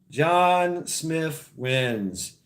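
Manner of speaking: dragged out very slowly, each word held; extra-slow dramatic reveal; very slow announcement. Acted. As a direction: savour the win, word by word. Repeats the winning name.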